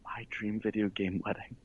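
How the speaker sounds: hushed, sentimental pretend emotion; mock choked up. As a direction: go very soft, as if moved by the thought. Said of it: "I get a little choked up".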